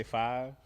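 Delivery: five with a long, drawn "ive". Shown as a demonstration. Midwesterner showing how his word sounds, long I in "five". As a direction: stretch the I in "five". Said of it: "I guess my "ive" is long".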